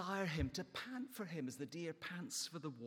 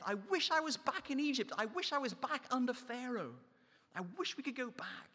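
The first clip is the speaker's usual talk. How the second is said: higher, louder mock whine; whining Israelites; 8 semitones higher, 4 dB louder than usual. Preacher acting out the grumbling Israelites, higher mock whine. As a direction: wail like spoiled ex-slaves longing for Egypt. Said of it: "three times they're saying".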